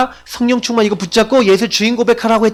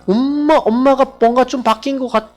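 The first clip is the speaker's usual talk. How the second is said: put-on higher voice of a wondering kid; imitated puzzled child; 4 semitones higher than usual. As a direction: lightly play a child noticing something odd. Children wondering about changed mother. (의아해하는 아이 흉내)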